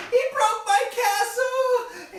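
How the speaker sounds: slow, high, loud wail; crying. Acted. Baby sister keeps crying to Mother about her castle, slow high wail. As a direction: drag the words out through tears. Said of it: "the little baby sister's crying".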